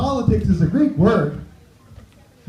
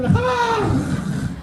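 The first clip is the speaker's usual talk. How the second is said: high mock cry of grief; mock Klingon scream. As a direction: wail it like a Klingon mourning a buddy. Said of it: "you scream".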